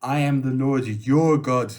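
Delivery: loud, emphatic declaration as God; God addressing Moses. Acted. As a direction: proclaim it firmly to one man. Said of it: "God says".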